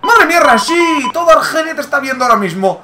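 high, loud goal celebration; excited goal shout. Real, not acted.

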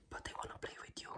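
breathy secret whisper, almost no voice. Acted. Tarot reader whispering the secret to her Leo viewers.

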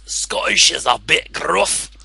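slow put-on Scottish accent; drawn-out Scottish burr. Shown as a demonstration. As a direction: demonstrate a gruff Scot. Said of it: "Can you do Scottish?".

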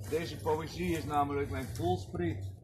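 ordinary talk

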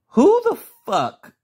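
high, loud, slow disbelief; indignant outburst. Real, not acted. Reviewer objecting to the new Catwoman, indignant.

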